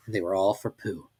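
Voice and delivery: ordinary delivery, nothing marked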